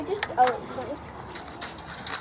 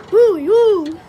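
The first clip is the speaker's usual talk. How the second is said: loud playful hooting; 3 semitones higher, 12 dB louder than usual. Pretend. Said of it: "do it again".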